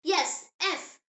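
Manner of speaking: ordinary talk, nothing marked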